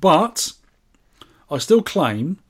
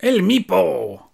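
slow, higher put-on voice; stretched-out, hammed-up name call; playful name echo; 5 semitones higher, much slower than usual. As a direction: draw the name out in a mock-grand voice. Playfully echoes a player's name.

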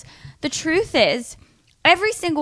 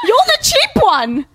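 high, loud indignant cry; passionate outburst; 13 semitones higher, 6 dB louder, much slower than usual. Real, not acted. Host scolding the bride over cheap evites, passionately outraged. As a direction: let it burst out shrieky and half-laughing. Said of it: "Sorry, I'm really passionate about this".

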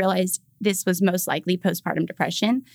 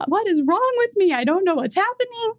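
much higher, panicked bewilderment; distressed; 12 semitones higher than usual. Retold or imitated. New mom asking herself what is wrong, panicked high voice. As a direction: cry it out, lost and scared. Her inner distress retold.

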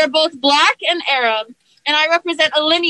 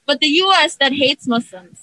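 ordinary talk

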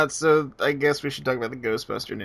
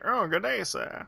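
higher, softer fake British greeting; light snooty British toff; lightly mocked British greeting; mock posh British; 6 semitones higher, 6 dB quieter, slower than usual. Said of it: "I don't say things like".

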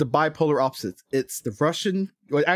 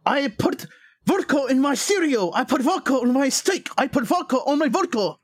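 high, hammed-up fake Russian accent; mock Russian accent; 10 semitones higher than usual. Host parodies the film's Russian villain, mock Russian accent. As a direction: boom it out in a cartoon Russian accent. Mocking Russian stereotype character.

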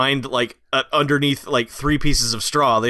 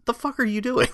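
higher baffled squeal; mock disbelief; 11 semitones higher than usual. Reacting to the useless stunt driving with a higher squeak. Exasperated at the car chase.

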